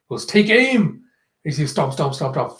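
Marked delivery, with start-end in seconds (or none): 0.3-1.0 raised, pitched-up order; acted command. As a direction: call out a mock military order.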